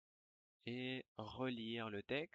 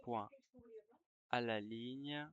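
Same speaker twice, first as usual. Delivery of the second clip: dictating. Shown as a demonstration. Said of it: "je vais dicter une dictée".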